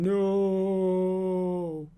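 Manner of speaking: long, drawn-out mock scream; Anakin. Host imitating a film character's scream, mock anguished wail. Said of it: "Anakin screaming".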